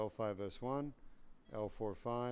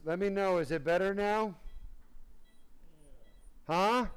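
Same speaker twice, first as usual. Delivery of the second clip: higher and louder, coaxing a reply; loud prompting; 6 semitones higher, 4 dB louder than usual. Real, not acted. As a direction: call out to get his answer. Presses patient for answer.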